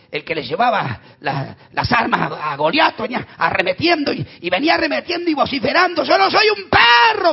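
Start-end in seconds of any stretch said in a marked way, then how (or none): 6.0-7.3 much higher, louder, slow furious yell; Goliath shouting. Retold or imitated. Goliath charging down at David, furious shout. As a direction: roar it like an enraged giant.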